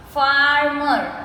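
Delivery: "Farmer" drawn out as far-mer; slowly broken up. Shown as a demonstration. Teacher counting two syllables, "Farmer" slowly broken up. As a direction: stretch both syllables of "Farmer". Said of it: "we say it slowly and we break the word".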